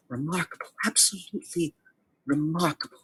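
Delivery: soft, high-pitched wonder; hushed awe, lifted pitch; fascinated marvelling. Acted. Game master voices Shyla marvelling at Rolex.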